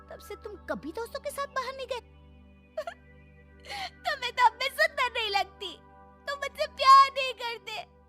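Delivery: high-pitched, hammed-up sobbing complaint; tearful wailing. Neglected wife to husband, feeling unloved, theatrical wailing. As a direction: wail it like a melodramatic wife.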